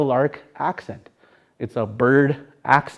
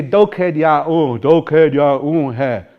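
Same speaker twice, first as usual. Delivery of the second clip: "doked yaun he" drawn out in a lilt, falling at the end; sing-song "doked yaun he"; meadowlark song tune; much slower than usual. Shown as a demonstration. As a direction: stretch "doked yaun he" slowly to a birdsong tune. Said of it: "with that song".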